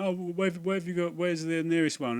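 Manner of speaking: high, put-on naive asking; mock-innocent question; playing dumb. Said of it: "playing dumb".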